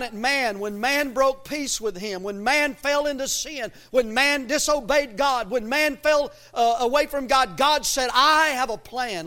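high, loud, building pulpit cadence; forceful preaching. Real, not acted. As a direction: pile up each clause with rising fervor.

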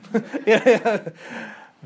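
loud high burst of laughter; hearty laugh. Real, not acted. Laughs at own caveman bit.

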